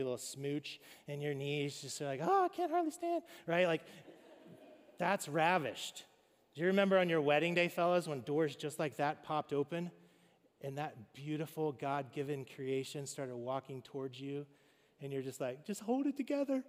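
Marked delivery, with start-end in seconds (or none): 2.2-3.2 high, breathless swoon, weak at the knees; swooning sigh. Retold or imitated. Pastor mimics a wife going weak after a hug, high swooning. 15.6-16.6 high, tight, strained plea to himself; fighting back tears. Retold or imitated. Groom at the altar urging himself to stay composed, high and strained.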